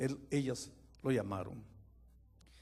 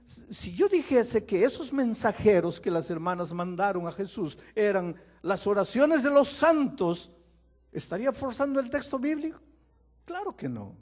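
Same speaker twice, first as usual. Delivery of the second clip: loud, high pulpit delivery; fiery preaching; full-force preaching; 7 semitones higher, 8 dB louder than usual. Real, not acted. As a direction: drive the point home from the pulpit.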